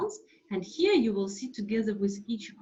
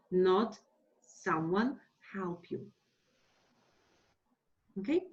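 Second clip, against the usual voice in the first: ordinary delivery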